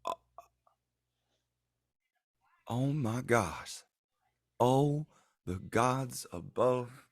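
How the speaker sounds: slow, dazed gasping at the news; stunned disbelief. Acted. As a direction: let it sink in, slowly, jaw dropped. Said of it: "just jaw on the desk".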